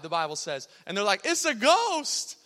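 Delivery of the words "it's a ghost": high, loud, drawn-out wail of alarm; hammed-up panic at a ghost; mock terrified cry. Disciples spotting Jesus on the sea, mock terrified. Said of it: "the disciples are terrified".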